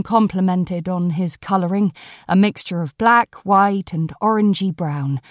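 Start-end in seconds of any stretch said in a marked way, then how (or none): none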